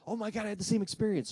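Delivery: high-pitched excited outburst; all excited. Retold or imitated. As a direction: blurt it out, thrilled to share it. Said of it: "I'm all excited".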